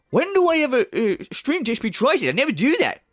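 high, protesting mock indignation; mimicking the streamer. Parodist imitating the streamer's denial of ever streaming, mock indignant. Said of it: "and now he's going".

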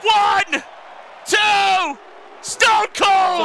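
high, shouted count to a win; triumphant commentator shout; pinfall count. Real, not acted. Counting the pinfall, announcing winner.

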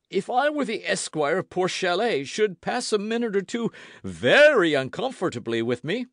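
high, blustering threat; indignant Porthos. A narrator's reading. Porthos threatens what he'd do to Roquefort, indignant.

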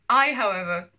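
loud, emphatic villain-monologue retake; grand declaration. Acted. Vlogger retaking childhood villain monologue, grand declaration. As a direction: announce it forcefully, with no laugh.

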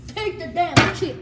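slow, loud and pushy; loud demand. Real, not acted. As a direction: bark it at him slowly. Pushing friend to take chip.

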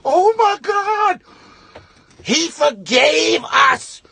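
high, slow stunned gasp; high and slow, a stunned host; shocked disbelief. Retold or imitated. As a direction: drag it out in horror. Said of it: "And I'm like".

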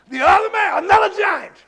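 high, very loud announcement; preaching shout. Real, not acted. Preacher announces the next giant to the congregation, shouting. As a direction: cry it out to the church.

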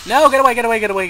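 real alarm yelled at an approaching creeper; panicked shout. Real, not acted. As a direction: shoo it off in a hurry. Fending off a mob.

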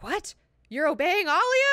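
high, incredulous mock outcry; acted shock, pitch leaping up; shocked disbelief. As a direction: blurt it out in high-pitched astonishment. Acts character's shocked question.

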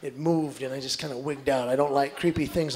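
ordinary talk, nothing marked

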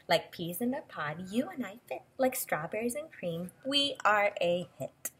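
lilting storybook read-aloud; expressive picture-book voice; reading to children. Acted. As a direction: read the book's lines to a little listener.

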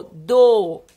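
"Do" loud with a held O; O sound demonstrated. Shown as a demonstration. Teacher isolates the O sound for pupils, demonstrated. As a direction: punch out "Do" and lengthen the O. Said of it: "E o som do O".

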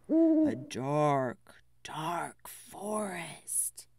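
low, slow hushed whisper; hushed storytime whisper; spooky whisper. Acted. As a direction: make the forest sound creepy. Spooky forest description.

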